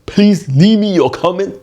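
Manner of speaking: high, forceful mock Cantonese accent. Shown as a demonstration. Comedian closing the video for viewers, Cantonese accent. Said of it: "Anyway, again".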